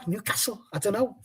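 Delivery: light Scouse lilt on Newcastle; a half-hearted Scouse try; Scouse-tinged, quiet and tailing off; Scouse accent. Retold or imitated. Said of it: "I've gone Scouse now".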